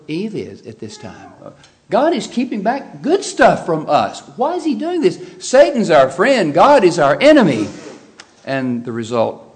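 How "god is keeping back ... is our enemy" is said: voicing Eve's doubts. Voicing Eve's tempted thoughts.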